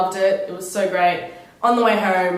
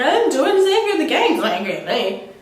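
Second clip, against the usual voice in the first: defensive denial; 7 semitones higher, 4 dB louder than usual. Retold or imitated. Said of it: "And I was like".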